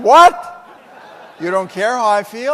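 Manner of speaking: loud, high, incredulous What; shocked outburst. Retold or imitated. Friend Joe shocked that Jim doesn't care, loud outburst. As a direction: blurt it out in disbelief.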